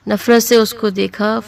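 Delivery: ordinary delivery, nothing marked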